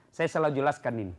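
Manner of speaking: ordinary talk, nothing marked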